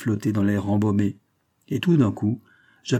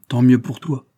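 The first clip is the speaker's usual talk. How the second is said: louder, sulky retort; understated angry comeback; mildly rageful; 2 semitones higher, 5 dB louder than usual. A narrator's reading. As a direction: fling it out with a touch of rage. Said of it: "Il déclara d'un air rageur".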